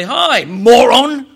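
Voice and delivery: loud, drawn-out "Hi, moron"; loud mock greeting. Preacher pretending to greet someone at their door, loud mock greeting. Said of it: "knock on your door and say".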